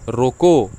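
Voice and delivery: रोको said loud, then a clear stop; pause after रोको. Shown as a demonstration. Teacher showing students where the comma falls, pause after रोको. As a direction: punch रोको and halt as at a comma. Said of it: "कामा, ठीक".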